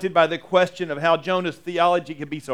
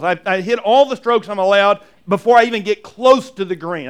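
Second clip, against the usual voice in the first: ordinary talk, nothing marked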